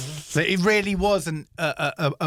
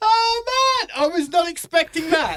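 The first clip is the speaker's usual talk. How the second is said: words squeezed out through helpless laughter; can barely talk for laughing, voice pitched way up; 11 semitones higher, 3 dB louder than usual. Real, not acted. Friend reacting to the spy joke, laughing helplessly. Laughing hard at the joke's punchline.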